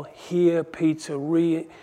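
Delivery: ordinary talk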